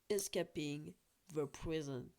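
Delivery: the title in a slow, mock English accent with a fall at the end; "Escaping the Prison" said the English way, slow and deliberate. Shown as a demonstration. Vlogger showing off his English accent to viewers, slow and jokey. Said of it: "mon accent anglais".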